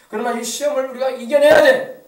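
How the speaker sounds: loud exhortation building to a shout; full-force preaching. Real, not acted. Preacher urging congregation to overcome trials, rousing full-force exhortation.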